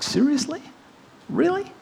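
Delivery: high, disbelieving "seriously, really"; shocked pitch leap upward; incredulous. Retold or imitated. Hearing that death is the end, incredulous. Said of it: "I was like".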